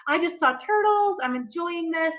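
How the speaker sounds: high, dreamy, delighted voice; blissful future self. Pretend. Imagined relaxed self savoring the snorkel, high and blissful. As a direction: float up into pure contentment. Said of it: "She'd be like".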